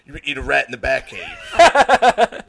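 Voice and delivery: loud, slow, hammed-up superhero order; Batman. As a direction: bellow it as a mock vigilante tyrant. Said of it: "He's like".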